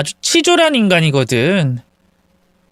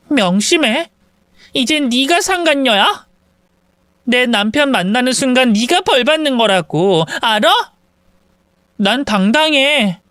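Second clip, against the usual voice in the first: higher pitched, firm threat; angry warning; 7 semitones higher than usual. Acted. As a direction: lay down the law, indignant. Rival issues a threat.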